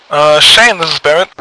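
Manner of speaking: loud radio call-out. Acted. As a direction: call the unit over the net. Leader on radio.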